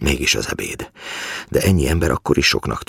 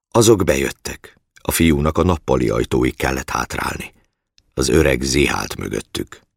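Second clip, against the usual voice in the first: usual speaking voice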